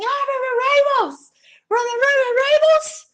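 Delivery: high, slow, drawn-out teasing call; mock American street joker. New Orleans locals teasing her in the street, slow high mock call. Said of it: "fazem piada com você na rua".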